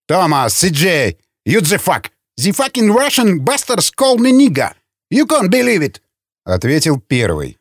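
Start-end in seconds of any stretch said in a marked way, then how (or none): 0.1-6.0 rising into a high-pitched complaint; indignant outburst. A narrator's reading. Insulted man complaining to the guard, indignant outburst. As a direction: whine it up high, outraged.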